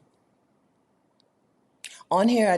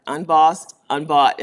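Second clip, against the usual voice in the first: proud declaration; 9 dB louder, slower than usual. Real, not acted. Quoting Shirley Chisholm proudly.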